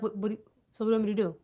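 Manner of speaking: mock confused reply; confused friend. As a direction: play the baffled friend, quickly. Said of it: "they're gonna be like".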